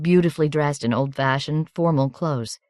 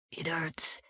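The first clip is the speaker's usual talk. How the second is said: whispering in pain; 13 dB quieter than usual. A narrator's reading.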